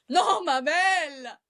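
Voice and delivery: high, drawn-out name call; hammed-up slow summons; mock scolding call. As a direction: stretch the name out high, nearly laughing. Mock scolding, calling the girl out.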